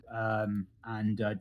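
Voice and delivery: ordinary talk, nothing marked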